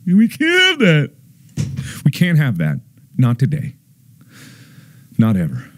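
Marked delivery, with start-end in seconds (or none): 0.1-1.1 much higher, strained mock panic; high mock alarm. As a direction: squeak it out in mock horror. Repeated line, first take.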